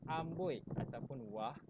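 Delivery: ordinary talk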